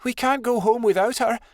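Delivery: high, distressed plea; upset protest. A narrator's reading. Adelaide refuses to go home without her friend, upset.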